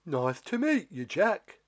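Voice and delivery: shy, pleading giant voice; hope he'll say yes; a friendless giant asking nicely; lonely giant. Acted. Giant greets Jack.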